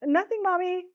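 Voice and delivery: put-on high, light child's voice; little girl calling back. A narrator's reading. Jill answering her mother across the room, high-voiced call. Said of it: "Jill called back".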